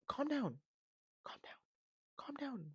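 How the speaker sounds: high, very soft hushing plea; tiny high voice, dropping almost to nothing midway; hushed calming down. Acted. Podcaster telling a director to calm down, hushed. Hushing the filmmaker's religious zeal.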